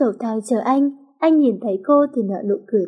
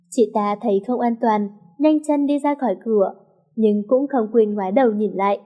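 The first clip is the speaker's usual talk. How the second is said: ordinary delivery, nothing marked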